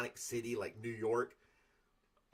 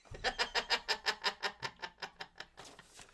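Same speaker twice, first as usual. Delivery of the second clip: laugh; 9 semitones higher than usual. Real, not acted. Laughs at own triathlon joke.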